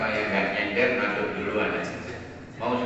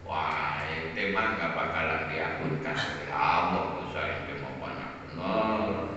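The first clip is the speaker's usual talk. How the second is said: scornful judge; 8 semitones lower, 5 dB quieter than usual. Retold or imitated. Judgmental worshipper condemning a sinner.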